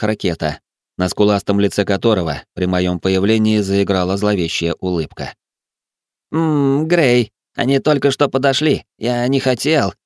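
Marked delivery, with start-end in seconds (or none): 6.3-9.9 high, pitiful whining excuse; plaintive bleating. Acted. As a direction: bleat it out like a nervous coward. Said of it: "жалобно заблеял Джан". (жалобное блеяние высоким голосом)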